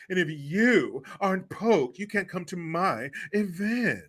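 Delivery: slow, preening put-on voice; proud, mocking imitation. Mocking a band barring unvaccinated fans, proud and preening. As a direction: mimic smug self-importance, drawing out every word. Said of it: "They're so proud of themselves when they say that".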